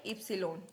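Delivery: German Y named इप्सिलोन, falling at the end; German letter Y. Shown as a demonstration. Teacher showing German Y, इप्सिलोन as "ip-si-lon". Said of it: "Y को क्या बोलेंगे?".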